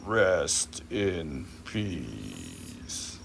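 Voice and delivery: slow, low mock-solemn tribute. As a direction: drag it out like a eulogy. Solemn joke about Undertaker.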